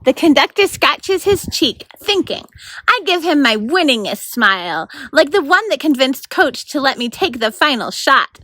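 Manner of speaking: lively read-aloud narration; story narration. A narrator's reading. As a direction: read it like a bedtime chapter. Narrator reads chapter aloud.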